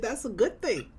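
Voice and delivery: usual speaking voice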